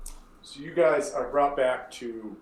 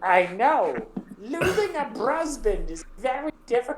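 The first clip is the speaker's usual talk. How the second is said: Hilda's terrible voice; 12 semitones higher, 4 dB louder than usual. Acted. Hilda introducing herself to the adventurers, high terrible voice. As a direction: drag it out like a gnarled old widow.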